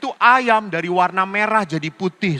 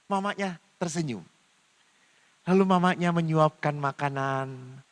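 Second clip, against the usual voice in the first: usual speaking voice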